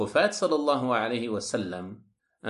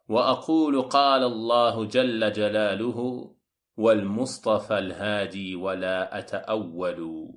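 Arabic verse recitation; 3 semitones lower than usual. Acted. Lecturer quoting the poem to listeners, intoned verse recitation. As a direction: recite the verse in measured chant, stretching the ending.

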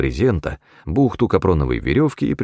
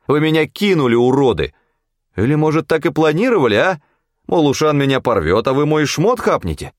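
higher, indignant accusing voice; heated accusation; 8 semitones higher than usual. A narrator's reading. Hunter accusing cronies of setting him up, indignant. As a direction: accuse them, pitched up.

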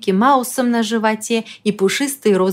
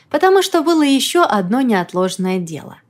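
ordinary delivery, nothing marked

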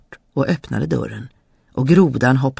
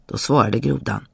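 ordinary delivery, nothing marked

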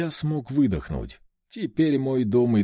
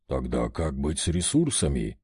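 low, pensive question, much lower; thoughtful; 10 semitones lower than usual. Acted. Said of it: "задумчиво спросил вождь".

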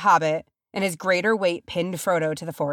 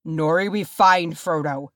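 firm, mildly sharp order; curt command, no argument; snapped; 4 dB louder than usual. A narrator's reading. Dwarf leader ordering his companion, snapped. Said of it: "Thorin snapped".

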